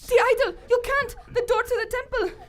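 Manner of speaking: high, loud, alarmed; alarmed protest. Acted. Manjula protesting as Tana steals the idol, loud alarmed cry.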